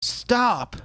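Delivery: very high yell; desperate shout. Acted. Trying to stop the killer at the edge, very high shout. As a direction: scream "Stop!" at full stretch. Chasing the villain on the cliff.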